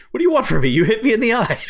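loud, high-pitched imitated outrage; mock pissed-off. Podcaster imitating Alan Arkin still sore about his eye, mock pissed-off. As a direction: complain indignantly, playing it for laughs. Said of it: "be pissed that the guy hit him in the eye".

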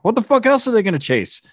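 high, exasperated disbelief; incredulous retort. Retold or imitated. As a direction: toss it back as if it's obvious.

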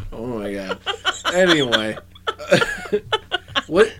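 usual speaking voice

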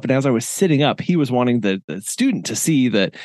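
ordinary talk, nothing marked

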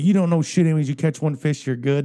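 mocking angry retort. Old man jeering at the kayak kid, loud and high.

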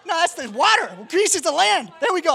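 high, loud playful exasperation; loud, high-pitched guiding; loud correction. Real, not acted. Correcting helper at the map.

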